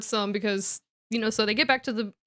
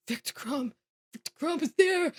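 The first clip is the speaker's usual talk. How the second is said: starstruck fan; 6 semitones higher than usual. Retold or imitated. Fangirling Ron voiced in story.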